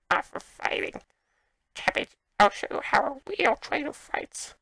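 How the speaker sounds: badly faked old crone slipping into Gollum; wavering creature-like granny voice; old lady and Gollum. Gamer voices Agatha challenging the player, bad old-lady accent. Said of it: "from old lady to Gollum, back to old lady".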